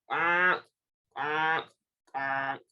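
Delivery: low, spaced-out quacks; big duck quacking. A narrator's reading. Reader voicing the big ducks for children, low quacking. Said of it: "The big ducks say".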